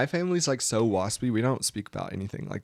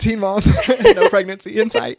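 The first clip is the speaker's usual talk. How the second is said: laughing; 11 semitones higher, 12 dB louder than usual. Real, not acted. Laughing at teen mom joke.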